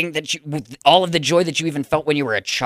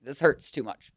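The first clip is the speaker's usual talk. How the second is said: pained; 6 semitones lower, 10 dB quieter, slower than usual. Acted. Hurt by being unliked, gives up.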